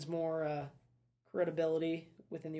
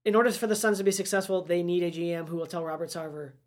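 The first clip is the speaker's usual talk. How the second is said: ordinary talk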